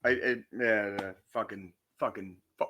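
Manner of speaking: ordinary talk, nothing marked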